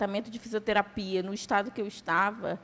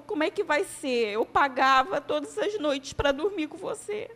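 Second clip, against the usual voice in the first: mother crying; 6 semitones higher, 4 dB louder than usual. Retold or imitated. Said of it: "E ela começou a chorar".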